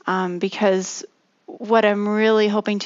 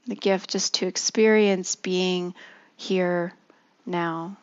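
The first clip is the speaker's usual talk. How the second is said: guided meditation. Acted. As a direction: ease them gently into stillness.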